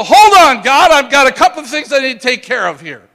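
loud, high call to make someone wait; hammed-up shout of objection; mock protest. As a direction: imitate people hollering a halt to God. Acting people stalling God at rapture.

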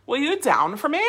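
put-on valley girl lilt swept up at the end. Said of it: "It's my valley girl".

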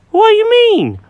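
high, fussy cat voice; Jenkins the cat. Acted.

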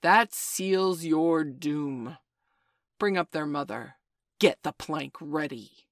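loud, slow menacing roar. Acted. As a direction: roar it out slowly. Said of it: "and Hook roared out".

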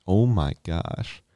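ordinary delivery

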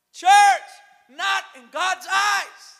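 loud, high, slow pulpit shout; rousing shout. Real, not acted. Preacher driving the point home to the church, shouting. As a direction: cry it out to the congregation word by word.

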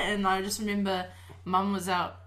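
normal talking voice

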